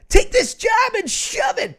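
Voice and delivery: very high, loud defiant shout; shouted job quitting. Pretend. Host quits a fan's job for them, shouting. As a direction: yell it out as a hammed-up resignation.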